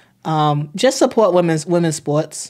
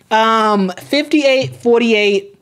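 ordinary delivery

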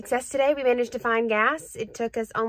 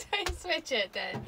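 laughing; 10 semitones higher, 8 dB louder than usual. Real, not acted. Playful laughing banter.

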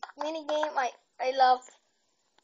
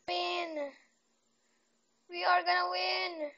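ordinary talk, nothing marked

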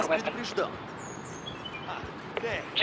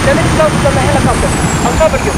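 urgent shout; 6 semitones higher, 4 dB louder than usual. Acted. Urgent orders in battle.

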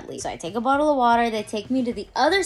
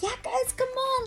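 much higher, pumped-up crowd call; hyping up the crowd; 5 dB quieter than usual. Retold or imitated. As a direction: fire up the audience. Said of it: "I'm like".

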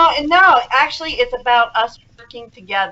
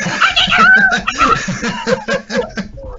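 high, laughing, stretched-out wail of panic; retold frantic cry, drawn out and laughing; mock panicked cry; much slower than usual. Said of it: "And I'm going".

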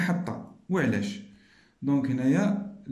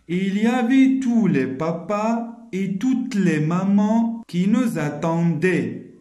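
slow, loud, a touch higher; slow careful reading aloud; model sentence reading; 4 semitones higher, 5 dB louder, slower than usual. Shown as a demonstration. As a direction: read the sentence slowly and clearly for pupils. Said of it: "غادي نقرا هاد لي دو phrases".

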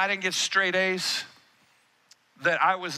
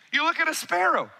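normal talking voice

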